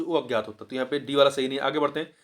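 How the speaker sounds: normal talking voice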